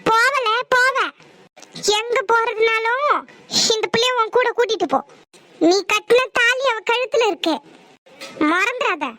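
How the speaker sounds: high, stern warning, slowing on the middle line; stern rebuke. Acted. Elder confronts departing son. (கிளம்பும் மகனை உயர்ந்த குரலில் கண்டிக்கும் பெரியவர்)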